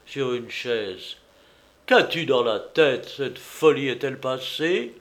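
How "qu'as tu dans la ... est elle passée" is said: ordinary delivery, nothing marked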